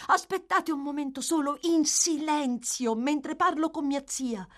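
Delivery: high feminine voice, gently reproving. A narrator's reading. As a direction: voice a concerned young lady. Narrator voices the young lady.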